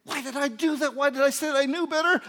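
high, loud wail of regret; hammed-up, frantic self-scolding; anguished self-reproach. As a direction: cry it out in disbelief at yourself. Acts out shock at own sin.

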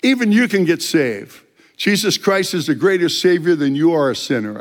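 loud, high proclamation to the biker; street preaching. Retold or imitated. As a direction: preach it out over the noise. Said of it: "And I go".

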